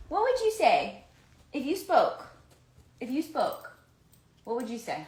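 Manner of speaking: high, loud sing-song question to the pet. Real, not acted. Asking her dog Ford what he would say, in high baby talk. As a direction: coo at the dog as if it could answer.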